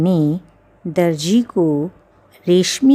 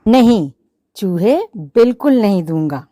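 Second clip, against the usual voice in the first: shouted, fast no; loud refusal; 8 dB louder, faster than usual. Acted. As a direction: slam the refusal down. Shopkeeper flatly refuses.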